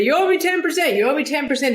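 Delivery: high, insistent mock demand; John McEnroe. McEnroe nagging her at a Lakers game, insistent. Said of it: "he'd say".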